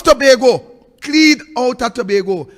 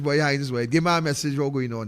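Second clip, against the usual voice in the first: ordinary talk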